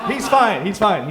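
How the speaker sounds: loud, high, over-insistent reassurance; defensive protest. Acted. Comic to audience about the missing parakeet, defensive insistence. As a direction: jump in loudly to head off their worry.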